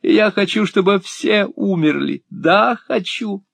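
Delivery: put-on enraged girl wailing that she hates everyone; sobbing with rage. A narrator's reading. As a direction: spit it out in a fit of tearful spite. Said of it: "разрыдалась от злости".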